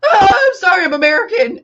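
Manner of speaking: very high, loud imitation; mock panicked apology. Friend imitates the other's scooter getaway, panicked shriek. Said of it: "but you went".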